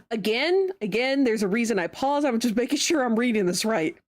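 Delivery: normal talking voice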